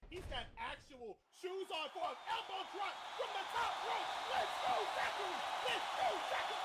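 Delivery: high, loud cheering call; rally for the cover count; wrestling commentator. Real, not acted.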